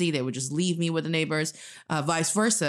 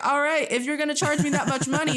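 high-pitched, laughing protest; hammed-up indignant retort; mock indignation; 10 semitones higher, 3 dB louder, faster than usual. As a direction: complain in a raised, incredulous voice. Acting out complaint to apartment receptionist.

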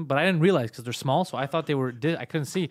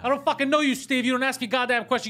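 mock angry yell; 9 semitones higher than usual. Grill master yelling at nosy neighbors, mock angry yell. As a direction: chase them off like a furious griller.

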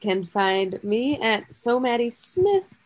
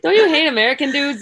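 ordinary talk

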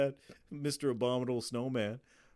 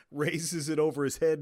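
ordinary delivery

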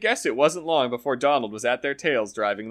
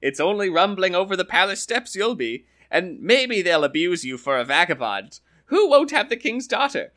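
lively higher voices for Donald and the farmer, trading lines; storyteller's character voices; 6 semitones higher, 5 dB louder than usual. Acted. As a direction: play the Irish tale's quarrelling characters with a raised voice. Donald's voice in the tale.

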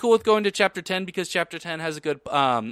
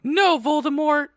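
high-pitched theatrical protest; hammed-up high shout; shouting back; 9 semitones higher, 4 dB louder than usual. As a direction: cry out in defiant mock horror. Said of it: "I shouted back".

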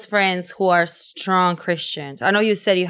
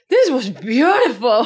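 laughing mock; 5 semitones higher, 5 dB louder than usual. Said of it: "he was legit just like".

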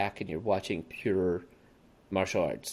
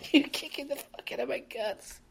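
mock agony in a very high voice; hammed-up bad dub acting, strained high; bad actor; 3 dB quieter than usual. Mimicking bad actors getting kicked in the guts, hammy high wail. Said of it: "none of them were that good of actors".

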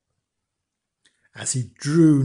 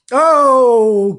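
a higher, louder cry of longing; eager cry; 8 semitones higher, 9 dB louder than usual. A narrator's reading. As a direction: cry out the wish with yearning. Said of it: "cried Mister Vinegar".

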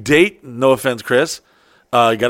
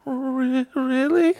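much higher, a mocking girly voice; whiny girlfriend; 12 semitones higher, 4 dB quieter, much slower than usual. Girlfriend telling him she has no pants, mock whiny. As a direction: imitate her plaintive excuse. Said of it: "She's like".

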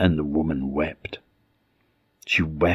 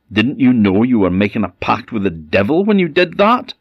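stern rebuke; 6 semitones higher, 6 dB louder than usual. Acted. Minister rebukes his wife.

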